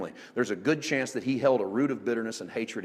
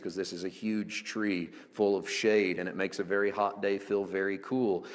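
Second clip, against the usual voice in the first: normal talking voice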